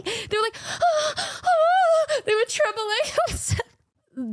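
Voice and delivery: high, trembling mock gasp of fear; mock terror. Imitating friends freaked out by a snack, mock terrified. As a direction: ham up a terrified shriek.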